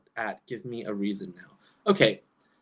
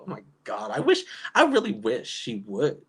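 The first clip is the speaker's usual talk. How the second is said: ordinary talk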